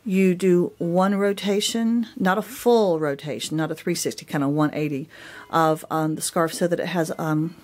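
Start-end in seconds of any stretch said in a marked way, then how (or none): none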